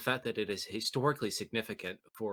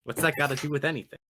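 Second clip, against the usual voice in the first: laughing; 5 semitones higher, 5 dB louder, much faster than usual. Real, not acted. Laughing outburst at a joke.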